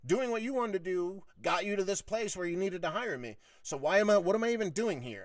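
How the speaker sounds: high-pitched, heated complaint; worked-up re-enactment of a grievance; retold exasperated argument. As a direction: argue it out as if he were there.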